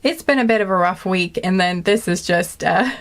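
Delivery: ordinary delivery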